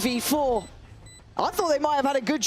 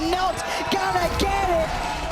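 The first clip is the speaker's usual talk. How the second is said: excited shout; 6 semitones higher than usual. Real, not acted. Caster calling clutch round.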